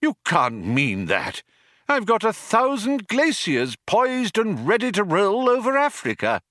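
appalled protest climbing high; aghast. A narrator's reading. Old man defends his glaciers, aghast. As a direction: protest in rising disbelief.

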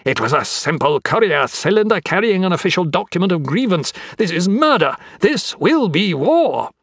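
high-pitched, theatrical outrage; worked-up, high accusing tirade; pretended rage. A narrator's reading. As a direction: rail at him in showy indignation. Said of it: "trembling with his pretended rage".

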